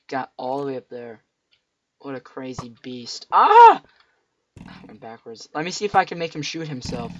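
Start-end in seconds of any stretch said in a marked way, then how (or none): 3.3-3.8 sudden high yelp; startled scream. Real, not acted. Gamer hit by an arrow, loud startled scream. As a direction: shriek as the hit lands.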